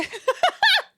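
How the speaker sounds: high-pitched giggle; squeaky burst of laughter. Real, not acted. Greeting Lil, bursts out laughing.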